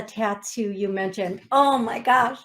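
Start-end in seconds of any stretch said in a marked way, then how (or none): 1.5-2.4 a sudden loud oh my gosh, caught off guard; stunned gasp. Real, not acted. Medium hearing the caller's confirmation, shocked loud exclamation. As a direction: blurt it out, genuinely shaken.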